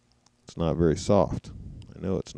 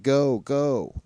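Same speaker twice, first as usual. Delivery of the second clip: raised-pitch mock yell, held back; attention-getting yell; 7 semitones higher than usual. Commentator showing a yell to get his attention, mild mock yell. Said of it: "that kind of yell".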